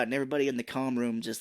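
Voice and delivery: normal talking voice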